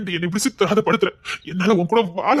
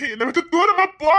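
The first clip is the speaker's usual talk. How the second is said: desperate cry; 8 semitones higher than usual. Acted. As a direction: cry out high, begging her to go. Angry lover drives her away.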